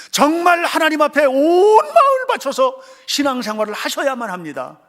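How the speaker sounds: high, loud tearful preaching; crying preach. Real, not acted. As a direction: cry it out with full force. Impassioned sermon climax. (울부짖듯 설교)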